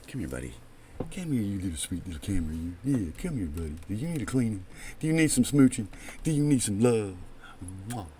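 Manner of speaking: soft, hushed coaxing like to a puppy. Real, not acted. Streamer sweet-talking his blurry camera, hushed and cooing. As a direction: coo softly to win the camera over.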